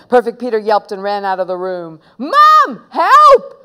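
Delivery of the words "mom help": high, loud wail for help. A narrator's reading. Little brother calling mum for help, loud high wail. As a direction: cry out for mum at the top of your voice. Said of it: "wailed Peter".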